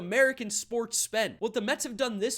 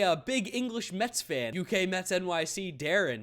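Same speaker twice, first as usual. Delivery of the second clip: ordinary delivery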